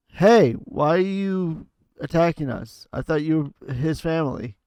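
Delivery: in-character yell. Acted. Player's character confronting the attackers, yelling. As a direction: yell it out as a demand for answers.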